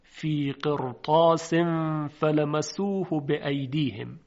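measured فی قرطاس فلمسوه بأیدیهم with a full ra in قرطاس; heavy ra recitation. Shown as a demonstration. Teacher quotes the verse as example, slow heavy ra recitation. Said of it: "حرف را هم تفخیم".

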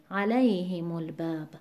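علیهم الباب with the stress on the syllable ل of علیهم. Shown as a demonstration. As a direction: lean on ل in علیهم.

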